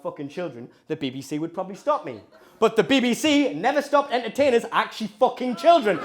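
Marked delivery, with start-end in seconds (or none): 2.6-6.0 loud, raised indignant rant; indignant outrage. Acted. Comic condemning the BBC's double standard, loud indignation. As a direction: build to a loud, outraged declaration.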